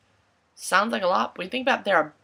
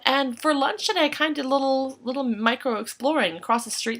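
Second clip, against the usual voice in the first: ordinary talk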